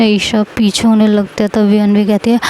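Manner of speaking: ordinary delivery, nothing marked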